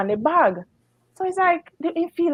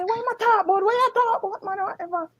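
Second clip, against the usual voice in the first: high-pitched imitated questioning; mock baffled onlooker, pitched high; other domino player; 9 semitones higher, faster than usual. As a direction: retell his puzzled questions in a much higher voice. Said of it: "So the other man".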